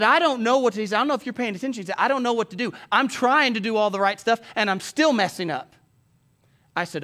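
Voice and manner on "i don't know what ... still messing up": raised, loud, exasperated pleading; retold frustrated outburst. Upset young man telling his pastor he's failing, exasperated. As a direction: blurt it out at a higher pitch. Retelling the crying caller's outburst.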